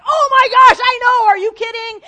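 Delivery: high, loud stranger yelling with joy; excited stranger shouting back. Retold or imitated. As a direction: shout it back like a fellow fan. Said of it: "the person that you don't even know is like".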